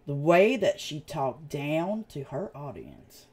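normal talking voice

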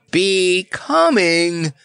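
high, drawn-out indignant cry; screamed. A narrator's reading. Lion echoing the bird's compliment, outraged scream. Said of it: "screamed the Cowardly Lion".

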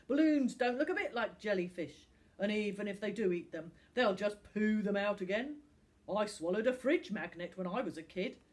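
loud, scornful driver; dismissive Sam. Acted. Sam the driver rubbishes Finn's warning, loudly dismissive. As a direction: brush it off loudly, unimpressed.

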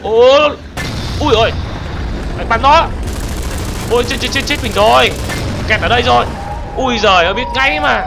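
loud high panicked cries as the monsters attack; panicked yelling. Real, not acted. Gamer shouting to viewers as monsters trap him, panicked. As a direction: yelp and shout in real alarm. Ambushed by Siren Heads in game.

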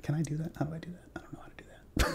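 whisper. Real, not acted. Muttering confused to self.

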